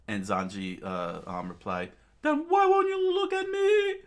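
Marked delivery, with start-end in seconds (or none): 2.2-3.9 very high, loud falsetto yell; furious shouting. Acted. Sanji confronts his evasive captain, furious shouting. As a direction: screech the demand, stretching the final words.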